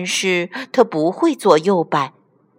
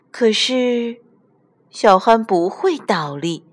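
ordinary delivery

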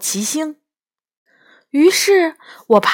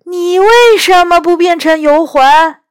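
high, loud, indignant yell; acted high-pitched shout; 8 semitones higher, 8 dB louder than usual. A narrator's reading. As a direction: yell the question back at a rival. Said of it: "第二个声音大叫".

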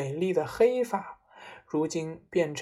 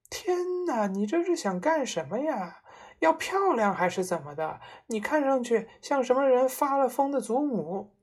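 higher, wailing reproach; exasperated scolding; 6 semitones higher than usual. A narrator's reading. Mother mocks her husband's new look, exasperated. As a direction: scold with mounting dismay. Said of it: "妈妈叫嚷着".

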